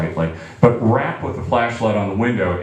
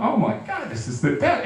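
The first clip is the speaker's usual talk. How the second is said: excited; 11 semitones higher, 4 dB quieter than usual. Retold or imitated. Giddy teen in the car.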